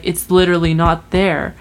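ordinary delivery

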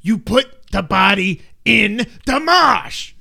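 put-on hammy Boston gangster accent, drawn out; bad Boston accent. Nicholson's gangster gives orders, loud Boston accent. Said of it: "I'm doing the bad accent".

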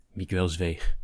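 usual speaking voice

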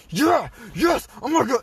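high, loud mock triumph; imitated hype. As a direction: celebrate permission, imitating an overexcited coworker. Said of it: "when she was all hype".

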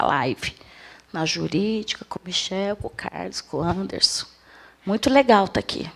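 voz deprimida, baixa e grave, quase sumindo; desânimo fingido. Shown as a demonstration. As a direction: finja entusiasmo sem energia nenhuma, cabisbaixa. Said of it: "ninguém fica feliz assim". (desanimado de propósito, voz caída)

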